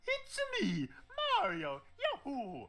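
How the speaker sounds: very high cartoon plumber voice; Mario. Imitating an audition tape, high-pitched cartoon voice. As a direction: squeal it out like an excited game mascot. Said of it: "impersonating Charles when he was doing his audition".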